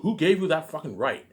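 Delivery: normal talking voice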